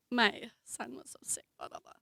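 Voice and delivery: whispered quick excuse under the breath; hushed apologetic whisper; whispered aside. Real, not acted. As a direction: drop to a whisper as a side remark. Hurried aside at memorial.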